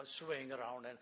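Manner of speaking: ordinary delivery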